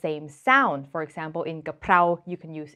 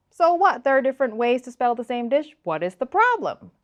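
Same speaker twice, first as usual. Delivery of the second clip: higher-pitched mock objection; quick, higher "so what" attitude; dismissive challenge; 3 semitones higher than usual. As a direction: brush it off as an imagined objector. Voices a dismissive viewer objection.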